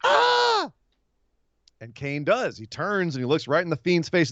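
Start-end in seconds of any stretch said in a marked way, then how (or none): 0.0-0.7 very high, slow squeaky cry of alarm; panicked crowd warning. Retold or imitated. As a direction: warn him shrilly like a frightened crowd. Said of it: "Everyone in the audience is like".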